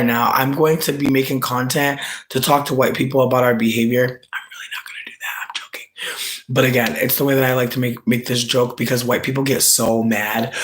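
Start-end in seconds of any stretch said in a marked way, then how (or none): 4.0-6.4 a voiceless whisper of a confession; whispered aside. Real, not acted. Streamer whispers to chat that she's only joking. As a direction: drop to a breathy whisper to let the joke out.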